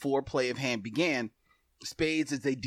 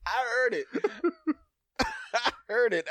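usual speaking voice

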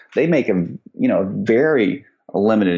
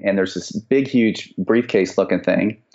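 ordinary talk

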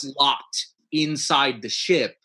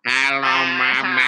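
alien voice; 4 dB louder, slower than usual. Acted. Alien talk demo.

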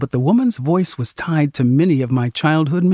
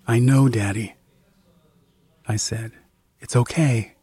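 comforting; 4 semitones lower than usual. Acted. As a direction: gently soothe a frightened parent. Comforting confused father.

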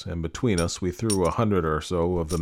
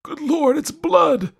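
very high, loud cry of horror; 8 dB louder, slower than usual. A narrator's reading. Old lord struck by his wife, crying out. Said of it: "I heard him cry out".

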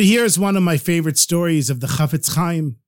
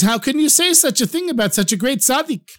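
high-pitched, affronted protest; indignant disbelief; 6 semitones higher, faster than usual. Acted. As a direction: protest as if the great man were insulted.

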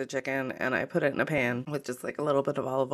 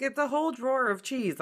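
incredulous; 10 semitones higher than usual. Retold or imitated. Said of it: "was like".

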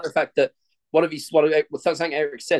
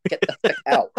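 disgusted yelp; 11 semitones higher than usual. Real, not acted. Recoiling from scary picture.

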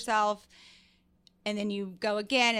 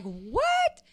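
surprised squeak; 12 semitones higher than usual. Retold or imitated. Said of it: "sometimes I'm like".

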